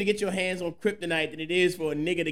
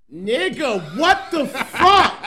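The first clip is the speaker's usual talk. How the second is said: tipsy bar argument; 8 semitones higher, 9 dB louder, much slower than usual. Real, not acted. Outraged at the rapping genie movie.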